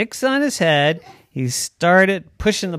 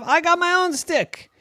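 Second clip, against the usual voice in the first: excited. Acted. Deer proud of antlers.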